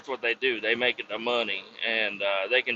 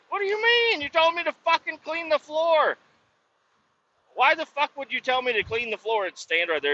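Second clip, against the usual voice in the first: much higher, mock outraged shout easing down; retold shouting match, high-pitched; incredulous yell; 13 semitones higher than usual. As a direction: argue back in disbelief. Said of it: "I'm like".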